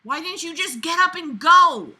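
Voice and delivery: usual speaking voice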